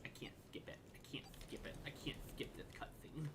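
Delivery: unvoiced whisper to herself. Real, not acted. Annoyed player whispering that the cutscene won't skip. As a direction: breathe the words out, no voice.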